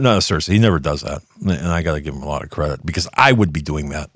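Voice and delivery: ordinary talk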